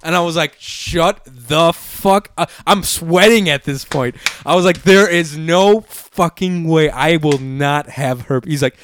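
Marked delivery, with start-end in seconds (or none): 0.6-2.5 slow, drawn-out disbelief; slowed stunned denial. Retold or imitated. Reacting to possible herpes diagnosis. 4.8-8.5 slow, insistent, every word leaned on; slowed-down panicked insistence; emphatic denial. Retold or imitated.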